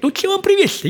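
very high laughing mock father; father asking. Father asks his daughters again, very high laughing mock voice. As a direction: squeak the question as dad, laughing. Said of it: "говорит".

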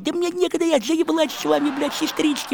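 high-pitched mock complaint; harried protest in a put-on high voice; imitated fed-up Cinderella. Cinderella snaps back at stepsisters.

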